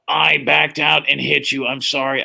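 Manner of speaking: slow robotic monotone of a voice box; electrolarynx voice. Retold or imitated. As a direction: imitate a man speaking through a throat device. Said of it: "it's one of those voice things".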